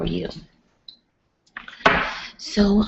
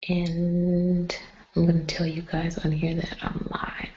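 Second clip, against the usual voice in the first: whispering at night; 6 dB quieter than usual. Real, not acted. Vlogger whispering so her sleeping family stays asleep. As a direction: keep it to a hushed whisper. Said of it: "I don't wanna talk too loud".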